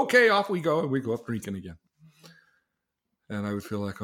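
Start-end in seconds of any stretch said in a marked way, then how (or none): 0.0-0.8 eager yes. Retold or imitated. Friends invite him drinking.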